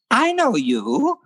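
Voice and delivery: put-on high, slow voice of an old Maltese woman; very high old woman's voice. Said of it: "this Maltese woman".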